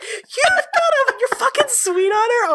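very high, slow, laughing squeal; gleeful teasing. Acted. Ren mocking the Arishok's feelings, high laughing squeal. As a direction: crack up mid-tease. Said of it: "He's like".

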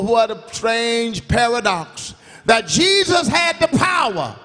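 ordinary talk

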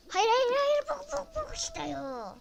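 high, loud, slow frustrated yells; angry outburst. Real, not acted. Gamer losing it at the game, high, loud yells. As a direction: explode at the game, pitched high. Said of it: "Gerçekten sinirlenmeye başladım".

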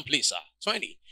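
ordinary talk, nothing marked

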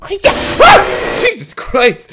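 very high, loud shriek; real jump-scare outburst; startled scream. Real, not acted. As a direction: scream in fright, then curse.

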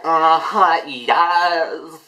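lightly mocked sly drawl, drawn out; imitated sly singer. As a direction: mimic a sly, self-satisfied "yes". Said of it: "She looks so sly, she's like".

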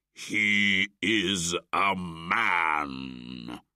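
very slow, loud tiger cry; cried out. Acted. Shere Khan crying out to the wolves, slow and loud. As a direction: drag out the accusation. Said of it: "cried Shere Khan and most of the wolves".

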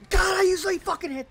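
loud, high exasperated outburst; frustrated yell. Real, not acted. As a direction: burst out loudly over the miss.

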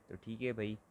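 ordinary talk, nothing marked

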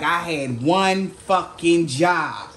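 slow, drawn-out exasperation at oneself; self-blaming groan. Retold or imitated. Person realizing their failure, slow self-blaming exasperation. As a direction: drag it out like you blew the one thing you had to do. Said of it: "Like, kinda energy".

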